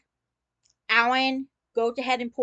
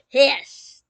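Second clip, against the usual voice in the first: pissed; 4 semitones higher, 9 dB louder than usual. Retold or imitated. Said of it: "I am so mad I can't even breathe".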